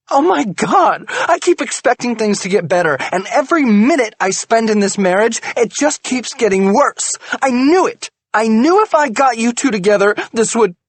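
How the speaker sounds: high, loud furious tirade; furious rant. A narrator's reading. Wife rants at husband about marriage, furious. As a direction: pour out years of grievance.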